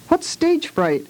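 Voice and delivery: high, slow worried question; a small boy worried about the recital. A narrator's reading. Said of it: "asked Warren with a worried tone".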